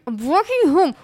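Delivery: husband answering his wife's call, loud and sulky; stubborn reply. Retold or imitated. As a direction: fire it back like a stubborn drunk.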